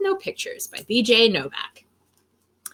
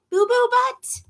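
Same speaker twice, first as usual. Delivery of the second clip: high, baffled echo of the name; incredulous question; 11 semitones higher than usual. Acted. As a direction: ask it in disbelief. Reader repeats name in disbelief.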